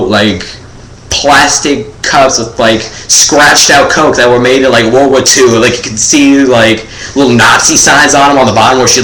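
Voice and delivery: ordinary delivery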